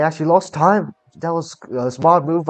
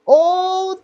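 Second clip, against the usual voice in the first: sudden high yell of surprise; surprised shout; 12 semitones higher, slower than usual. Real, not acted. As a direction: gasp it out, shocked. Car slides, both react.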